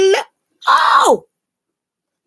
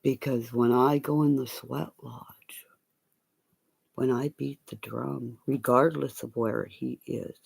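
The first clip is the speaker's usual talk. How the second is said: low, hushed confiding murmur, partly whispered; 14 semitones lower, 11 dB quieter than usual. Real, not acted. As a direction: lean in and tell the secret. Hushed threat and story.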